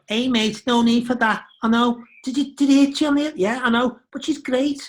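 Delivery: put-on Scouse accent. Actor mimicking Jodie's home Scouse voice, high and giggly. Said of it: "you become a scout, so you go".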